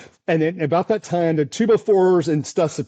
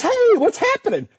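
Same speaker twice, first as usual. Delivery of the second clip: much higher, light imitation of his wife's cheery greeting; voicing his wife. Storm chaser imitating his wife answering his call, much higher. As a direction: squeak it out like a bright, chirpy wife. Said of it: "She goes".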